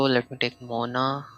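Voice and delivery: normal talking voice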